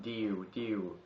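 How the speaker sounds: stiff mechanical voiced words; robot voice. Shown as a demonstration. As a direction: say it like a droning robot. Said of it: "And you say, like".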